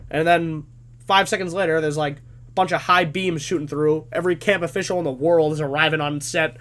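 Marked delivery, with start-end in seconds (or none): none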